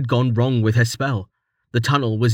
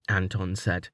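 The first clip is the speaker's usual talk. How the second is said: plain reading voice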